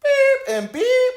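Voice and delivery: very high, loud, drawn-out squeal; a very high, loud, slow shocked cry. Retold or imitated. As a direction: shriek the names in disbelief. Bleeped names, high squeal.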